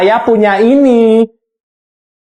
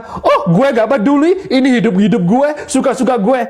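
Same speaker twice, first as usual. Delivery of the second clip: mock careless viewer; 2 semitones higher than usual. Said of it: "Sebelum kalian bilang".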